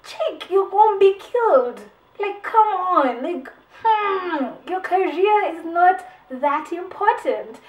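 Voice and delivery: high, loud, slow exasperated scolding; mock angry scolding. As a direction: scold the character like a fed-up friend. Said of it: "so angry at her".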